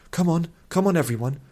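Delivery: high, anxious hurrying; agitated urging. A narrator's reading. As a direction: urge everyone along in agitation. Agitated princess hurrying companions.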